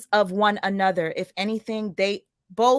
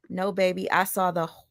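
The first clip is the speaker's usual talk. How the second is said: ordinary delivery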